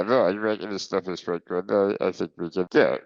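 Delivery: ordinary talk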